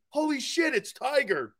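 mock starstruck exclamation, starting high; stunned blurt. Recalling the room howling at his reaction, stunned blurt. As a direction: re-enact a gobsmacked outburst, pitch dropping. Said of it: "I was absolutely stunned".

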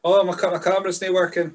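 normal talking voice

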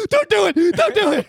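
high, loud frantic begging; panicked kid yelling. Retold or imitated. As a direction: scream like a terrified child. Kid begging mom to stop.

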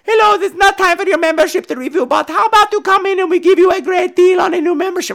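high, sing-song sales message voice; mock the gym owner's recorded pitch in a high voice; very high imitation of a voicemail; pre-recorded voicemail caller. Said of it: "pre-recorded call from her on my voicemail".

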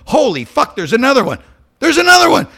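high, loud panicked yelling; panicked alarm. Retold or imitated. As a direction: shout it out as the fires multiply. Panicked discovery of more fires.

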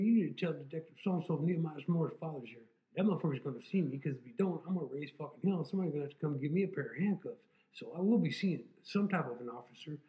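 loud, heated threats; angry demands. Retold or imitated. Demanding at police window.

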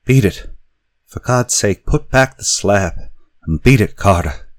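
high, loud, desperate cry; piteous cry. A narrator's reading. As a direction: beg him in desperation. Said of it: "a piteous cry from Warren".

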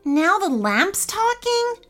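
high, slow incredulous question; squeaky surprise. Acted. Sister hearing the lamp talk, squeaky surprise. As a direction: stretch it out in squeaky disbelief. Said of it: "squeaked Ellie".

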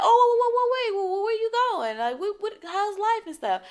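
high, rushed pleading voice; eager guy stopping her. Retold or imitated. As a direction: hurry to stop her leaving. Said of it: "He's like".

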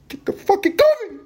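shrieking demand for coffee; furious shout. Retold or imitated. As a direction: blow up at the barista. Customer snaps again, shouting.

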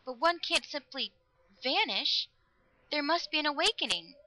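high, anxious plea; quivering. A narrator's reading. Girl insisting death cannot be the end, quivering. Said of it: "her voice was quivering".

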